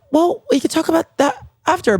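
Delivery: high-pitched flustered dodge. Retold or imitated. Answering husband's threesome offer, flustered and high. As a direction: squeak it out, caught off guard.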